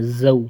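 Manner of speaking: "زو" with a firm, buzzing z; hard z. Shown as a demonstration. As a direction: press hard on the z of "زو". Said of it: "اس کو سختی سے پڑھنا ہے".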